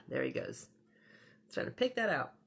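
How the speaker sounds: plain reading voice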